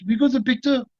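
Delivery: excited French-accented impression; high-pitched, hard-to-follow French accent; Jean-Claude. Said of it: "Do I have an impression of him?".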